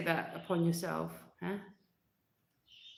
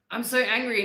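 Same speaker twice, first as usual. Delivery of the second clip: retold anger; 5 semitones higher, 7 dB louder than usual. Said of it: "if I got angry".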